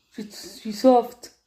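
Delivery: ordinary talk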